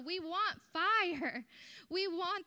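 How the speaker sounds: preaching shout. Real, not acted. Pentecostal preacher rousing congregation, slow loud shout. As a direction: proclaim it to the room.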